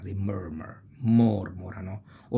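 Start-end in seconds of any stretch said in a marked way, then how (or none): 1.0-2.0 mormorano with a long, weighted first mor; stress on first syllable. Shown as a demonstration. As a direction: lean on MOR, let morano trail. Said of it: "the beginning of the word".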